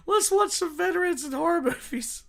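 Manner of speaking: very high squeal breaking into loud laughter; helpless laughter. Real, not acted. Streamer announcing veterans in horror movies, high laughing squeal. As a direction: squeak it out, then burst out laughing.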